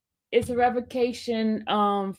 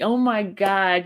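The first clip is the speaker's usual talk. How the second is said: ordinary talk, nothing marked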